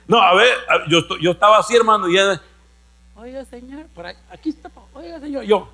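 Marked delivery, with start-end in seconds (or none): 3.2-5.4 a hushed, timid wife's voice; wife's timid call. Retold or imitated. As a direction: call him softly, unsure. Imita a la esposa llamándolo. (voz tímida de esposa que llama bajito)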